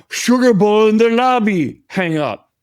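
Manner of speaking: loud, high-pitched announcement; insistent prank call. Retold or imitated. Repeat prank call, acted more insistently.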